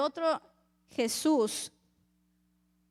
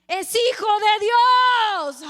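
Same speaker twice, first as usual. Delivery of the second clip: much louder and slower, booming like an echo; ringing echo; 5 semitones higher, 9 dB louder, much slower than usual. Acted. Preacher voicing the words echoing at Pilate, booming echo. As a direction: let it boom back slow and loud. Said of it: "le hicieron eco a Pilato así".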